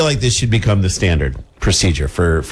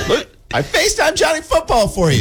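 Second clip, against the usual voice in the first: squeaky high cop voice; high mock cop voice. Mock cop at traffic stop.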